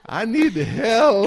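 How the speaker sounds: slow, high, hammed-up plea; mock pleading. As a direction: beg dramatically, drawn out. Pleading for IT cover.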